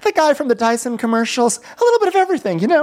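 very high caricature voice; squeaky-high mock musing; Rinna. Rinna listing accent guesses, very high caricature.

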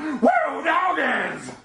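high whooping yell; wrestler catchphrase shout. Acted.

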